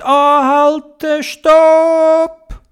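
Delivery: high, loud, long-drawn stop call; urgent halting call. Acted. Theobald spots something under a tree, urgent high shout. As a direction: call out to halt, stretching the words.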